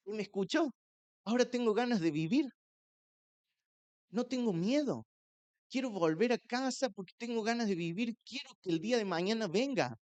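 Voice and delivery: high bright joy, rushing at first; elated. Retold or imitated. Man testifies he wants to live now, in high elated tones. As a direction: pour out the new joy. Joyful testimony after deliverance.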